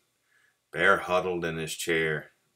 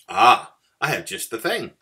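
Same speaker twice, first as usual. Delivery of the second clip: louder, higher eager mouse; cheerful; 5 semitones higher, 9 dB louder than usual. A narrator's reading. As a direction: pounce brightly on the perfect idea. Cheerful mouse offers a remedy.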